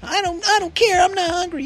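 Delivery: put-on high whiny child refusing; bratty kid voice. Son refusing food from his father, high bratty whine. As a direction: pout it out like a stubborn kid. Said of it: "just being a bratty kid".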